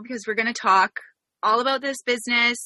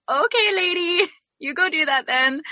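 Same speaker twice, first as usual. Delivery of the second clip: high mock-agreeable reply, dropping off; re-enacted puzzled going-along; baffled humoring; 8 semitones higher than usual. Host recalling her reply to a baffling announcement, humoring.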